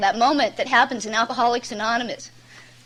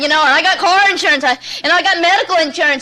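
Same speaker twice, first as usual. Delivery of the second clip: higher, louder desperate retelling; grabbing at excuses; 5 semitones higher, 5 dB louder than usual. Retold or imitated. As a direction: grab for any proof you can. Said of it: "start grabbing for everything you can".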